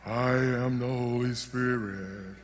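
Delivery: low, slow, drawn-out ghostly intoning; spooky spirit voice. Acted. Preacher mocks how people imagine the Spirit speaks, in a low spooky voice. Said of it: "talking like that".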